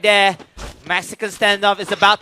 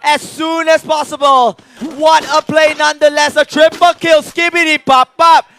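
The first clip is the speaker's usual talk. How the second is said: esports caster; 4 semitones higher than usual. Real, not acted. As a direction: roar the highlight like a fan. Co-caster hypes triple kill.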